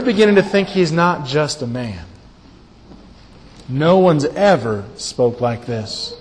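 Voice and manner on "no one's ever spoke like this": usual speaking voice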